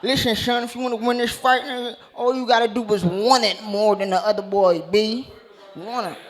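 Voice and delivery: put-on slushy-s New York rapper accent; New York coach. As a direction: imitate a Wu-Tang-style New York coach. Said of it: "sound like he in the Wu-Tang Clan".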